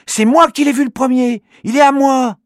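put-on high, loud protesting child; indignant boy's cry. Manu refusing his sister, loud indignant boy. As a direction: snap back in protest. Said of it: "s'écrie Manu".

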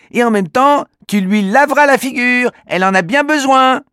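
put-on high, cheeky child voice; a boy teasing back; little boy voice. Manu teasing his sister, cheeky high boy voice. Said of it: "réplique Manu".

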